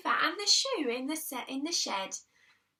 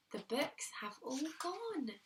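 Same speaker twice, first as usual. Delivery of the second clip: dismayed; 3 semitones higher, 8 dB quieter than usual. Acted. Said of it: "cried out Peter".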